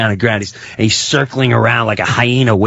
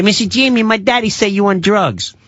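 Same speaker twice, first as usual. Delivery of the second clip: high-pitched mock child voice; neighbor's kid; 8 semitones higher than usual. Kid repeats daddy's gossip to neighbor, high mock child voice. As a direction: imitate a little kid tattling, pitched way up. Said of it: "Don't tell your kids".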